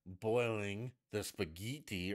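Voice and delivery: slow, singsong mock cooking host; mocking, drawn-out foreign-style "spaghetti"; imitating a celebrity TV cook. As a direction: stretch the recipe out, relishing "spaghetti". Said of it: "however the fuck she says it".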